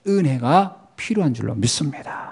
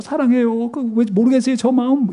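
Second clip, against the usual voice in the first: mock sappy Peter; 6 semitones higher than usual. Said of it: "베드로가 예수님 붙잡고 뽀뽀하고".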